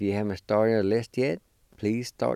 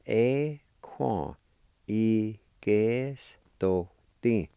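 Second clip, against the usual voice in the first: Y que esto ti drawn out slowly, syllable by syllable; slow careful demo; much slower than usual. Shown as a demonstration.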